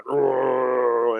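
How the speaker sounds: drawn-out growled roar noise; imitated roar. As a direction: mimic the roar of battle.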